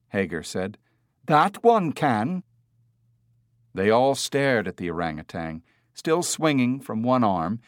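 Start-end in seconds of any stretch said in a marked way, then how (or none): 1.2-2.4 slow, higher, emphatic retort; insistent retort. A narrator's reading. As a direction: contradict slowly with raised voice. Man contradicts Hager.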